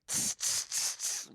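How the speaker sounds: whispered snare beats; quiet drum imitation; mouth snare hiss. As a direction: tick out a snare with your breath.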